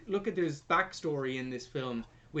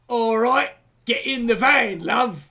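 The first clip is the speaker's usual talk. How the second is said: loud, higher-pitched mock Smee accent; 4 semitones higher, 9 dB louder, slower than usual. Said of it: "why didn't he speak like Smee from Hook".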